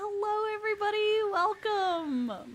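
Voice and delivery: high, loud, bubbly welcome; excited greeting. Real, not acted. Streamer welcoming Wispy Witch's raiders, excited. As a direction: throw your arms open to the crowd.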